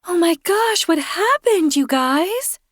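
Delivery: high, bright alarmed neighbor; Kirsten alarmed. A narrator's reading. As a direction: voice her high and startled.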